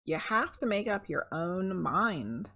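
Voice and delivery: plain reading voice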